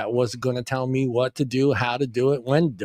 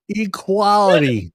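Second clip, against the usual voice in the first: slow drawn out; 5 semitones higher, 4 dB louder, slower than usual. Pretend.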